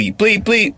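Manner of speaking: high-pitched bleep noise; two short high mock bleeps; imitated censor bleeps. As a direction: bleep out the swearing. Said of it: "call him all kind of exple".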